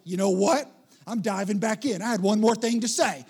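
mock combative. Someone restarting a made-up fight, mock combative shout. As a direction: jump loudly back into the argument, hammed up.

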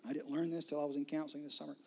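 ordinary talk, nothing marked

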